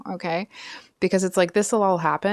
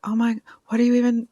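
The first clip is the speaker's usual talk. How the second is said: higher, quick mock disbelief; mock attitude; 5 semitones higher, 3 dB louder, faster than usual. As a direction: imitate an offended rival, rushing it. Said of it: "it's this kind of like".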